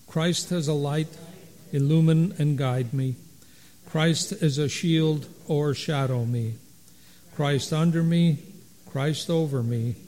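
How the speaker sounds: loud, steady liturgical recitation; unison recitation. Acted. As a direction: recite the canticle together, measured. Congregation recites canticle in unison.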